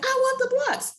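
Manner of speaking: put-on a toddler insisting on her toys; whiny toddler. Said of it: "I'm like".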